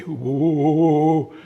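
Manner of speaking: long sung-out whoa of wonder; awed reverie. Retold or imitated. Man slipping into a reverie in the grocery aisle, dreamy awed whoa. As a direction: drift off into awe. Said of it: "kinda went into this reverie".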